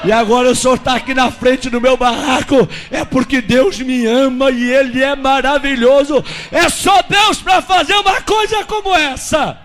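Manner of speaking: acted outburst of praise; exultant praise. Retold exultant praise to the pastor.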